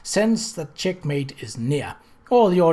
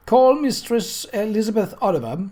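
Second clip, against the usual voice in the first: a raised, higher official call; coroner's summons; 7 semitones higher, 4 dB louder than usual. A narrator's reading. Coroner calling the child witness to the chair, raised and formal. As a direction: call the witness to the stand with authority.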